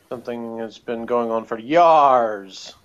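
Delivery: "yars" with a long rolled R; slow, loud "yars", half pirate. Retold or imitated. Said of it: "I sound more like I'm doing a pirate voice".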